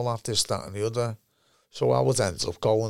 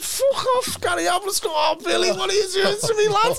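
outraged shout. Retold or imitated. Cousin shouting at the teller across the pub, high outraged yell. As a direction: yell it like he's been betrayed.

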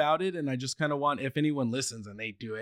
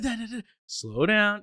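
high but slow Dad, Dad, Dad; a kid trying to slow his excited words down; Dad, Dad, Dad said high, then slow down drawn out; eager kid, slowed down; 8 semitones higher, slower than usual. Retold or imitated. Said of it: "And I'd be like".

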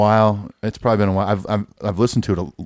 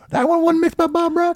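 hillbilly voice; 3 dB louder than usual. Pretend. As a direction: play a backwoods old-timer, pitched up. Hillbilly voice bit.

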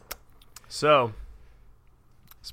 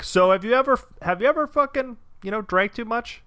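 higher, prodding questioning voice; mock senator question; 10 semitones higher, 3 dB louder than usual. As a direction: poke at him like a careful senator. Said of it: "Klobuchar would just be like".

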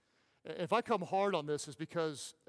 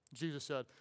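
ordinary talk

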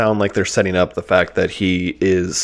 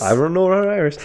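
higher-pitched echo of a tough-guy line; playful mock tough guy, pitched up; Harvey Keitel impression; 9 semitones higher than usual. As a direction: parrot the movie line after a laugh. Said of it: "he talks pretty much the same way".

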